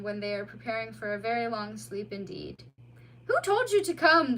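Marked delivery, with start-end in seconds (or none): 3.3-4.4 high, loud demand; near-shout to keep him awake; almost shouting. A narrator's reading. Mole to the dozing otter, almost shouting. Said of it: "the mole almost shouted".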